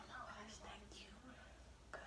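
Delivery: breathless whispered relief; whispered thanks. Retold or imitated. Girl thanking God she can finally eat, whispered. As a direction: whisper it like a grateful prayer.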